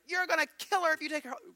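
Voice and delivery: a high, loud mock-alarmed doctor. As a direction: warn them off like frantic doctors. Doctors warning the family.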